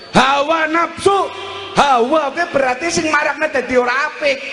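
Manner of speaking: high-pitched, forceful sermon delivery; preaching. Real, not acted. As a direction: drive each short phrase home like a preacher.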